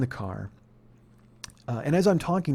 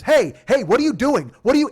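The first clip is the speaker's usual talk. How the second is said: loud, high cop shout; barked challenge; 7 semitones higher, 7 dB louder than usual. Retold or imitated. Cop rushing up to grab the angry man, shouting. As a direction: shout to stop someone mid-action. Cop rushes in to stop him.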